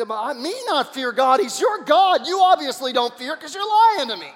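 high, loud indignant comeback; indignant rebuke. Acted. Abimelech turns the charge back on Abraham, loud and indignant. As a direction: throw the accusation right back.

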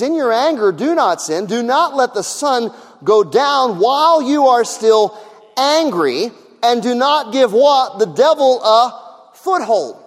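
loud, high, slowed scripture preaching; preacher pounding the warning home; full-force preaching. Acted. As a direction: thunder the verse out to the church.

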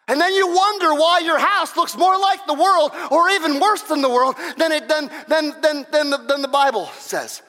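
loud, high, driving sermon voice; preaching at full force. Acted. Preacher rousing the congregation, loud and fervent. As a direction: pound it out like a revival preacher.

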